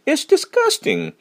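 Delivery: It's disgusting with a high, slow, disgusted pitch. Shown as a demonstration. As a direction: act like you might throw up. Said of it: "토할 것 같고 역겹고 이런 느낌이 막 살아나야 돼". (역겨운 느낌을 살려 높고 천천히)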